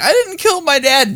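squeaky mock defense; imitating Philip Halsman. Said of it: "And he was like".

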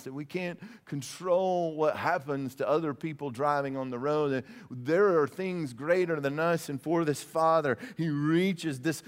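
ordinary talk

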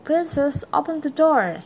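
mild high-pitched call. A narrator's reading. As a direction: lift the pitch as if calling through a door. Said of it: "Then a voice called".

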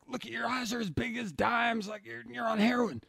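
high, incredulous cop voice; mock shocked cop. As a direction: imitate the cops gawking at his pupils. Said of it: "they're like".

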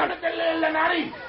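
high, slow angry shout. Acted. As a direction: yell the order at him. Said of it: "Ay, dejate de gritar".

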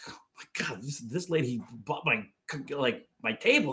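hushed, low, halting disbelief; stunned. Retold or imitated. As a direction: act out being quietly floored by what you see. Retold stunned reaction to video.

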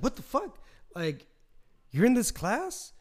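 high, slow incredulous question; a squeaky drawn-out disbelief; mock shock. Spotting a missing classmate at finals, high incredulous voice.

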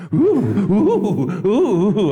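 high, loud hammed-up oohs; playful mock moaning, laughing; mock makeout moans. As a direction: moan it up like a fake makeout. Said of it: "after she was like".

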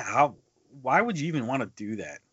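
normal talking voice

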